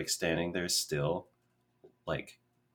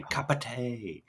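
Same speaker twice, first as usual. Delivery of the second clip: slow, drawn-out Cockney "tay"; imitated bad Cockney; 5 semitones higher, slower than usual. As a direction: mimic an actress's poor Cockney, higher pitched. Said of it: "she says in the Cockney accent".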